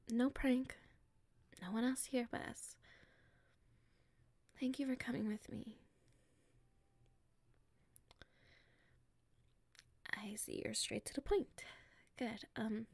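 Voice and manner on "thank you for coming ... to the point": hushed and secretive, keeping it private; nervous confiding whisper; shy whisper. Acted. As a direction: whisper so nobody around can hear.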